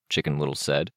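ordinary delivery

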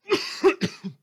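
high, loud cough bursting out; loud hacking cough. Acted. Radio host breaking off to cough, loud hacking cough. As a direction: hack it out mid-sentence.

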